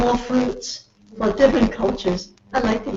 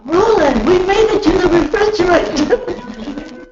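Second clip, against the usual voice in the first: calling out to Roland, higher and proud; excited call to husband; 6 semitones higher, 3 dB louder than usual. Retold or imitated. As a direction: announce it like big news, pitched up. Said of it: "talk to her husband".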